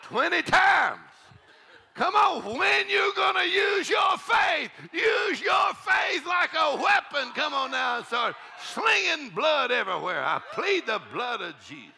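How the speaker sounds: high, loud, slow preacher's cry; rally them like a battle call; shouted preaching. Acted. Preacher urging the church to fight, shouting. Preacher erupts after the chant.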